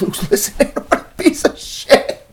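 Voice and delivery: high, giggling jab at Luke; half-whispered laughing taunt; laughing mock insult. Real, not acted. Mocking Luke, who is eavesdropping.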